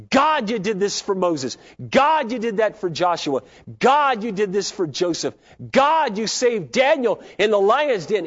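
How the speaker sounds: fervent prayer. Retold or imitated. Preacher reenacting his own prayer to God, fervent. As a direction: proclaim each line up to God.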